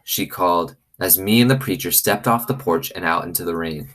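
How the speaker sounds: ordinary delivery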